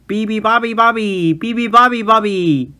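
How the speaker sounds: loud high roar. A narrator's reading. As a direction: roar it together. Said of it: "they roared".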